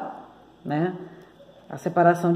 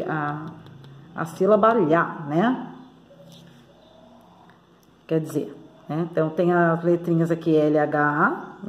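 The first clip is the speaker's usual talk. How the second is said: ordinary delivery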